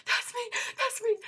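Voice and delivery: loud, high, repeated squeal; retold freaking out. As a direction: shriek it to be recognized. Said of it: "we were all freaking out".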